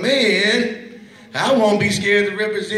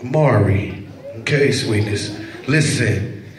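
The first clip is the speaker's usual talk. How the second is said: gentle aside; 8 semitones lower, slower than usual. Real, not acted. Gently addresses a child.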